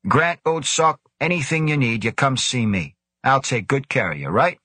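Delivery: high, hearty jovial voice. A narrator's reading. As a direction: clap him on the back with words. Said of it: "Devlin said jovially".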